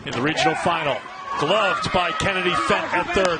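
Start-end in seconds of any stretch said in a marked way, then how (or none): 1.4-3.4 higher-pitched live call, building on the throw; lively baseball announcer wrapping up the inning; excited play-by-play. Real, not acted. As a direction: call the defensive play with rising energy, then punch the recap.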